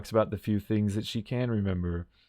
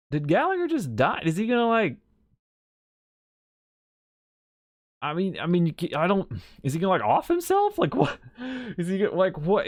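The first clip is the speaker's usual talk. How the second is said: baffled high questioning; 8 semitones higher than usual. Retold or imitated. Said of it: "And I'm like".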